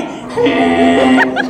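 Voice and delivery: low, loud foghorn honk of a laugh; sustained horn-blast laugh; imitated horn laugh. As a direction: honk like a ship lost at sea. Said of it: "she would just go".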